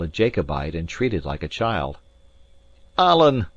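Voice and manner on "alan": high, loud call of protest; exasperated cry. A narrator's reading. Narrator protests to Alan about the scheme, crying out. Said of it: "I cried".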